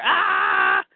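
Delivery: high, drawn-out mock battle cry; leaping attack yell. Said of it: "other guard's leaping into the air".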